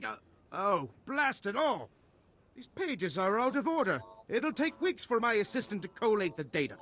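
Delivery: high-pitched, flustered indignation; exasperated outburst. Acted. Scientist finding the research pages jumbled, exasperated. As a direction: fume in a high, fussy voice.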